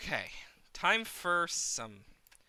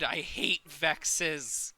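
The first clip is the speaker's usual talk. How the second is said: slow grumble; much slower than usual. Real, not acted. Drawn-out complaint.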